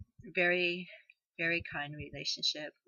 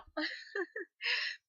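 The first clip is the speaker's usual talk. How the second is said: high broken sob; choked-up real cry. Real, not acted. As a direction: let the sob break through. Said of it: "I'll get it together".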